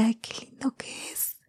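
whispered, swooning Ay, qué lindo que es; whispering adoringly about her boyfriend; dreamy whisper. Retold or imitated. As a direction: sigh it out in a lovestruck whisper. Retold swooning over boyfriend.

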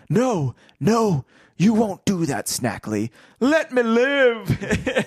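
high, panicked, drawn-out begging; terrified pleading. Acted. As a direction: beg for your life in terror. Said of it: "The other voice rose, replete with terror".

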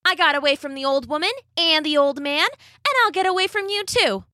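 high, fast boastful cookie; gingerbread man voice. Acted. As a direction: brag it, quick and cocky.

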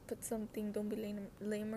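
ordinary talk, nothing marked

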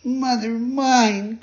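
high, slow frightened cry; scared cry. Acted. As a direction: wail it out in terror, high. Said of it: "cried the marionette, scared to death".

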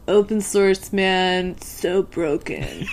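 slow, lazy stoner drawl; laid-back dorm-room stoner; college stoner voice. Retold or imitated. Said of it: "My, like, college stoner voice".

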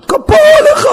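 very high, emotional outburst; gushing stranger. Retold or imitated. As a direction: imitate an overjoyed fan's high cry. Grateful stranger at the car window. (חיקוי נרגש בקול גבוה)

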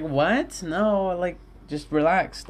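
retold exasperation at mom; incredulous protest. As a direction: push back in disbelief. Exasperated reply to mother.

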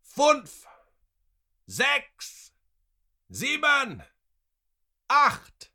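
very high, loud shout; one ringing, high yell; shouted count. A narrator's reading. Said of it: "shouted the man who was keeping count".

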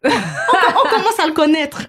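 high, drawn-out words through a laugh; amused, laughing. Real, not acted. Host joking with co-host about a celebrity, laughing.

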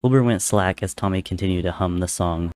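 ordinary delivery

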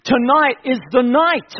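loud, high urgent altar call that drops away on come; preaching at full force. Real, not acted. Preacher calling listeners forward tonight, full-force preaching. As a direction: plead hard, then soften to an invitation.

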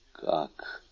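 hushed, low, stunned question; horrified bewilderment. A narrator's reading. Prosecutor to doctor, in horror and bewilderment. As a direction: ask it softly, aghast. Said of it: "с ужасом и недоумением воскликнул".

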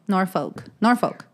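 Norfolk said "Nor-foke", silent L; Norfolk without L. Shown as a demonstration. Friend showing how locals say it, Norfolk as "Nor-foke". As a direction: drop the L in Norfolk. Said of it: "I don't think people pronounce the L".

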